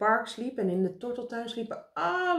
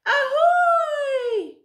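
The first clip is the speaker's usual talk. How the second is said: long, very high bird cry; shrill screech; 10 dB louder than usual. A narrator's reading. Karel the bird hails them from above, shrill screech. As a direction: screech it loud from high overhead. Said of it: "een schel gekrijs".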